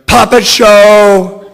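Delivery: high, very loud, slow shout. A narrator's reading. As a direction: belt it out like a wild announcement.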